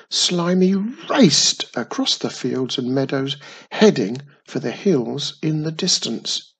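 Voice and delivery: ordinary delivery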